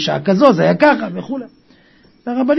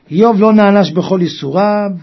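ordinary talk, nothing marked

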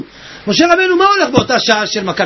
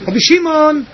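composed radio-host imitation; קול של מנחה רדיו; 2 semitones higher than usual. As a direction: call the name politely, like a radio presenter. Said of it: "קול כזה של מנחי רדיו". (חיקוי מאופק של מנחה רדיו)

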